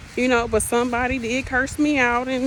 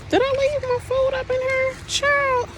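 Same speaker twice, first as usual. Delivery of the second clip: high, startled realization about the food; high-pitched startled exclaim; surprised squeal. Real, not acted. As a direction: suddenly shoot way up in surprise. Finds her food left behind.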